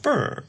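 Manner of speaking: squeaky high brr; a silly high-pitched brr; high mock shiver noise. Mocking sound after quote.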